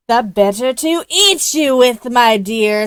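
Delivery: loud savage snarl. A narrator's reading. Wolf snarls loudly and pounces on Little Red. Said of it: "snarled the wolf".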